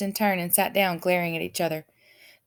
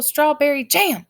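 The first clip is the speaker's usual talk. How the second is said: shouted; 8 semitones higher, 7 dB louder, slower than usual. A narrator's reading. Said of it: "shouted Officer Shrift".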